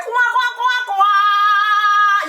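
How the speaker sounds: high, drawn-out quacks; mock bird quacking. Vlogger telling her she's a bird, high quacking. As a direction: quack on a held high note.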